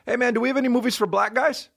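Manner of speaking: high fast mocking caricature. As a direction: blurt it out in a silly mock voice. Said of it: "he says".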